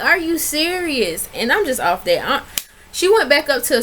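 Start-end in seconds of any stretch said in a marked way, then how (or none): 0.0-1.3 incredulous. Real, not acted.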